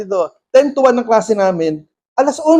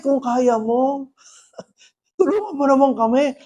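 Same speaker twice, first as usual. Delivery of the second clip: pleading father; 3 semitones higher, 4 dB quieter than usual. Retold or imitated. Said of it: "sabi nung tatay". (nagsusumamong tatay, mataas ang boses)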